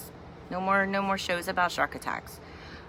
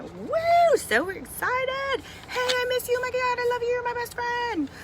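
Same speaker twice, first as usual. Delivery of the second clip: very high gushing cheerleader squeal; teen gushing to a friend she missed; top cheerleader. Retold or imitated. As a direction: gush like a bubbly cheerleader. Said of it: "she's like twenty twenty's top cheerleader".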